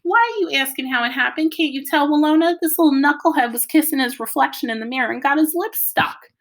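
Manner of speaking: high, loud teasing outburst; incredulous mocking dad. A narrator's reading. Dad to Mama about their knuckleheaded son, loud mocking.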